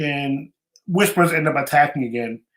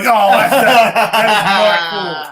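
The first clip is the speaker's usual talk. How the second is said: laughing mock complaint, louder and slow; laughing protest; 5 semitones higher, 7 dB louder, much slower than usual. Real, not acted. Reacting to the actor's spoiler joke, laughing. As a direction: chuckle through a fake grievance. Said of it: "laughing at the spoiler".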